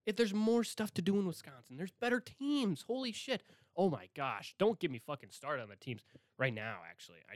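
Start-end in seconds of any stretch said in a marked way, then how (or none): none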